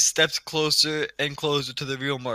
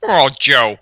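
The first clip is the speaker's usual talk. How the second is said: louder lazy Peter Griffin grunt; Peter Griffin impression; 10 dB louder, much slower than usual. Demonstrating Peter to friends, loud lazy impression. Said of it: "Peter Griffin sounds like".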